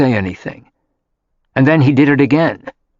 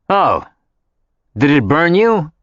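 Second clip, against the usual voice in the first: sarcastic; 4 semitones higher than usual. Acted. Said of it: "he asked sarcastically".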